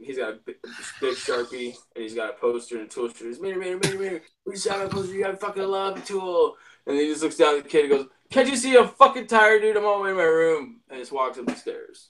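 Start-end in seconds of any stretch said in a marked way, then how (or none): 3.4-6.6 pleading fan. Retold or imitated. Fan begging the singer. 8.3-10.8 annoyed rock star. Retold or imitated. Singer snapping at a kid.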